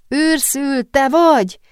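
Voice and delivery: loud, hopeful-sounding call. A narrator's reading. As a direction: call to your friend, pitched well up. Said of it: "szólt fennhangon".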